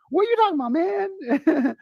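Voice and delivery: very high, fast quoted banter; high-pitched friend impression. Imitating his best friend from Brooklyn, high and fast. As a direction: imitate an excitable pal, voice far up. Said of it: "why you're talking so high pitch".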